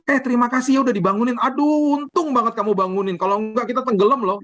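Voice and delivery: high, fast gushing thanks; mock grateful Jesus. Pastor mocks what Jesus never said, high gushing thanks. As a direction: gush it like a relieved, overly thankful friend. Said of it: "dia nggak pernah bilang gini".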